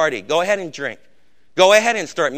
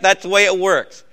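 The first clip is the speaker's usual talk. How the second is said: ordinary talk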